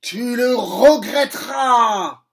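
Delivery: cri rageur, lent et bien plus fort; rage écumante. Acted. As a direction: hurler une menace de vengeance. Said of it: "Crochet écume de colère". (Crochet, fou de rage, crie sa menace)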